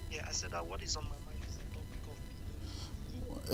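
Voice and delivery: faint, slow and far-off voice; too quiet to catch on the line; barely audible. Real, not acted. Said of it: "Again, we can't hear you".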